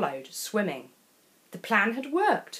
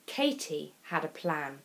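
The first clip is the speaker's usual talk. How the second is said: ordinary delivery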